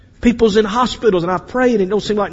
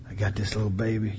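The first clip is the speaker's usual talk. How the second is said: soft reply; 10 semitones lower, 12 dB quieter, faster than usual. Acted. Pharaoh's daughter reply.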